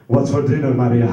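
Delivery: loud, matter-of-fact call to the wife; father asking casually. Retold or imitated. Father afterwards calling to his wife about dinner, loud and casual. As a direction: bellow it casually, like nothing happened. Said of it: "he's gonna ask my mother".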